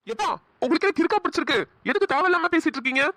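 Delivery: high-pitched, agitated protest; angry outburst. Acted. Son objecting to his father in hospital, angry outburst. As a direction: burst out impatiently, pitch pushed well up. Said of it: "ஏன்பா இப்படி கத்துற? பொறுமையா பேசு".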